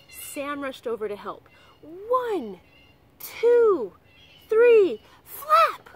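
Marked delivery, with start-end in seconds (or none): 1.8-5.9 counting call, building. Acted. Arthur counting off the fanning, building to a loud shout. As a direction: count rising higher and louder to a shout. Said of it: "Arthur called".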